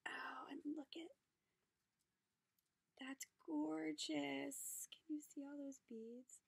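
hushed, breathless whisper of delight; excited whisper. Real, not acted. Vlogger whispers to viewers over the gifts, hushed and delighted. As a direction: whisper it like a secret treasure.

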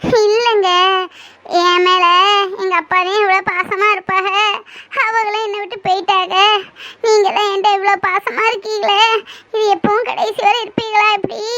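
weeping as she speaks of her father; crying to her lover about lost love. Acted. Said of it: "ஏன் பூமாரி இதுக்கெல்லாம் அழுற?".

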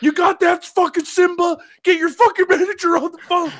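high-pitched rant of an irate sergeant; retold yelling. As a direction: imitate the sergeant chewing out the guard. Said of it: "he was yelling at this guy".

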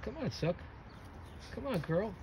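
high, soft coaxing of the dog; gentle coaxing. Real, not acted. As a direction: coax her along gently.

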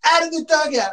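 high-pitched summons; imitated order. Teammate making the pitcher leave the dugout, imitated order.

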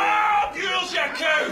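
high, shouted outburst at a machine; furious shout. Acted. Passenger to the stubborn lift, furious shout. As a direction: explode in rage, pitch jumping up.

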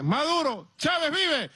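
high mock street cry; imitated calling out. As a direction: mimic a crowd hailing you with a slogan. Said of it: "mucha gente que me dice".

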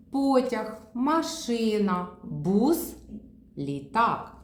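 Потяг high, машина very slow and drawn out; slow word list. Shown as a demonstration. Therapist reading the picture row, very slow машина. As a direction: read the row slowly, one by one. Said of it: "Перевернули".

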